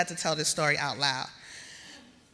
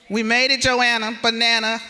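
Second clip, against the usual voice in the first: excited call out; 4 semitones higher, 7 dB louder than usual. Real, not acted. Celebrating with friends in crowd.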